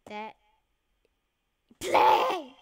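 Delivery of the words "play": shout. Real, not acted. Restarting the game.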